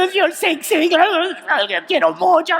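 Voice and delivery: high choked-up sobbing fragments; crying words. Retold or imitated. Nervous speaker sobbing out broken words on stage, high and choked. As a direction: blurt broken words through mock tears, high. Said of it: "de vez en cuando salía una palabra".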